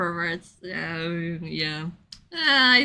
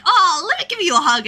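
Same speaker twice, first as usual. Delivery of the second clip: higher, louder, fast cheery offer; Kaito eager; 8 semitones higher, 6 dB louder, faster than usual. Retold or imitated. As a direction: bounce in with a cocky hug offer. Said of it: "Kaito's like".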